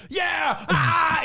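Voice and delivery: very high mock cheer into a shriek; imitated excited screaming. Grown man thinking he got a game console, imitated excited screaming. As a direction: whoop like someone overjoyed by a gift.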